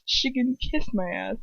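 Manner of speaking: ordinary delivery, nothing marked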